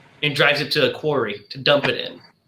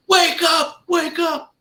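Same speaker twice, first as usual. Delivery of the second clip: retold shout; 13 semitones higher, slower than usual. Man shaking the crate, yelling.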